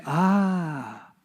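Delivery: ordinary talk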